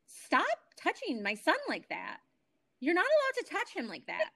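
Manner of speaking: firm mother's protest, acted out in the retelling; retold angry scolding. Mother telling off clinic worker for rough handling, retold indignation.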